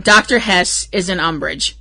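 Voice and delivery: ordinary delivery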